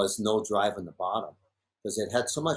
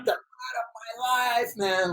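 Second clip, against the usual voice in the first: much higher, gloating yell; cocky Brock; 12 semitones higher than usual. Retold or imitated. Imitating Brock bragging after his ride, high cocky gloat. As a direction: crow it like you just won. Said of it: "like cocky Brock".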